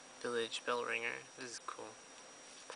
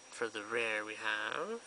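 ordinary delivery

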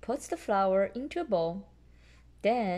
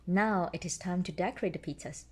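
usual speaking voice

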